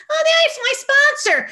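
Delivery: very high, loud squeal; mock gushing sponsee. Flaky sponsee spotting her at a meeting, squealing. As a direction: squeal it in a put-on voice. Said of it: "that half woman that I'm talking about".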